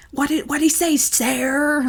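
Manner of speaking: high, sharp imitation of mother demanding; angry mother impression. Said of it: "calling me Sarah when she was angry".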